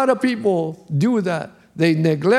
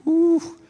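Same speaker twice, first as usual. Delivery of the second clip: ooh; 9 semitones higher than usual. Real, not acted. Exclamation after the vision.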